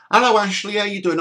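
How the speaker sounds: high, bright-ish woman's greeting; lightly voiced woman on the phone; [name]'s voice.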